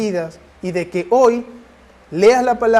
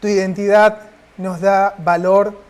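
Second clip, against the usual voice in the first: earnest, measured prayer voice; heartfelt prayer. Acted. Preacher leading the congregation in closing prayer, earnest. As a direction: pray it out to the Father, steady and sincere.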